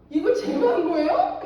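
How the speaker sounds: retold stunned protest with a laugh; indignant disbelief. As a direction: react as if it's absurd. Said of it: "제가 기가 막혀서".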